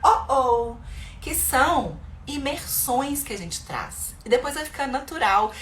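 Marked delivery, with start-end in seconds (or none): 0.0-0.8 playful ouch and uh-oh. Shown as a demonstration. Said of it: "você fala".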